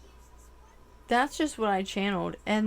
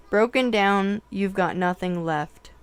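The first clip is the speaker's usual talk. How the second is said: lyric reading; 6 dB louder than usual. Acted. Reads song lyrics aloud.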